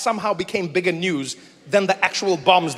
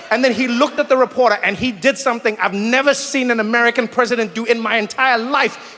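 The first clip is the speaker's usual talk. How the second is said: usual speaking voice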